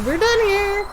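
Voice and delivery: high, mock-final brush-off; sassy dismissal. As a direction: close the matter with playful sass.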